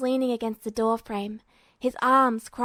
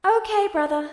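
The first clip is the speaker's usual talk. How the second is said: put-on higher, lighter boyish voice; eager younger brother; 8 semitones higher, 4 dB louder than usual. A narrator's reading. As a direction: sound young and eager on the phone.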